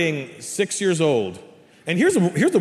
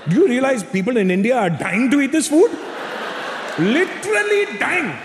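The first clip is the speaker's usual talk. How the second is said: put-on Indian mom accent; 9 semitones higher than usual. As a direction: scold the ungrateful kid in a thick Indian accent. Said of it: "My mom goes".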